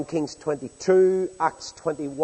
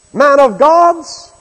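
much higher, louder, slow mock reverence; mocking sarcasm; 10 semitones higher, 14 dB louder, much slower than usual. Preacher voicing soldiers jeering at the prophet, loud mock reverence. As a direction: drag it out in loud scornful mockery. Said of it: "is the implication".

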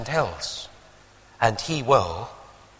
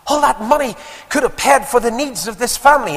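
high, loud scolding of a parent; indignant daughter's outburst; angry reproof; 12 semitones higher, 7 dB louder than usual. Retold or imitated. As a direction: reprove your father, pitched high and loud. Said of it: "she started to reprove her father".

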